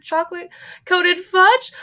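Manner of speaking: high, loud shriek rising on fudge; screaming. Acted. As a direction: shriek chocolate-coated fudge in horror. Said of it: "screamed Missus Gloop".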